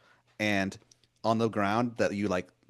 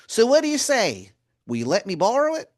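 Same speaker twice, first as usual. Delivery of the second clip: much higher and louder, a sweet-talking wizard calling you hun; Baltimore wizard voice; 13 semitones higher, 7 dB louder than usual. Acted. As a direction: wheedle like a pushy old wizard begging a favor. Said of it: "he's like".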